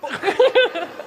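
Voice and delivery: high, loud booming villain laugh; Goliath mocking David with a high, loud laugh; terrible giant laugh. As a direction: roar with a wicked giant's laugh. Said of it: "Goliath laughed an even terribler laugh".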